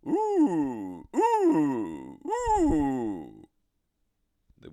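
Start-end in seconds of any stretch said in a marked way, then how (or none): 0.1-3.5 much louder, higher fearsome ooh calls; loud dragon roar-hoot. Acted. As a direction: bellow it to scare off the weasel. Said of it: "shouted in a very loud voice".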